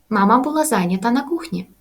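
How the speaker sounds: normal talking voice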